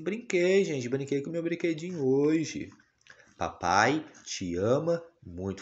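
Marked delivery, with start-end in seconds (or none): 0.0-2.7 put-on a higher, childlike voice telling what she did; dad speaking as his little daughter in a higher voice; a chirpy baby voice, high on the first word. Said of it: "Fala assim".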